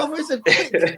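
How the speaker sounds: slow, high mock Geordie accent through laughter; laughing imitation. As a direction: giggle while parroting the accent. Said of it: "is that Geordie accent".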